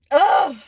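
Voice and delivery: a loud, real groan through tears; tearful groan. Real, not acted. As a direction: let out a heavy crying groan. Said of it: "these are, like, sad tears".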